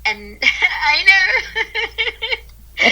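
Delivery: high, laughing through the words. Real, not acted. Guest laughing at her own calm mantra, laughing. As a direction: giggle it out.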